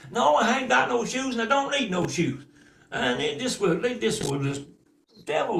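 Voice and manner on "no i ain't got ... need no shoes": put-on defiant girl. Girl answering cousins.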